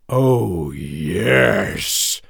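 menacing lion voice, drawn out; King Lion. A narrator's reading. King Lion answering the frightened mouse, menacing lion voice. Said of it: "King Lion said".

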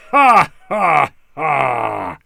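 loud, spaced-out mocking ha-has; big scornful lion guffaw, falling; lion's laugh. A narrator's reading. King Lion laughing at the mouse's offer, scornful laugh. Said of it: "King Lion laughed".

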